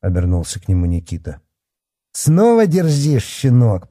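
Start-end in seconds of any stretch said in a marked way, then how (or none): 2.1-3.9 high, loud, drawn-out outrage; enraged. A narrator's reading. Policeman answered back by the boy, enraged. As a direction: scold him as fury rises. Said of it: "побагровел Бахметьев".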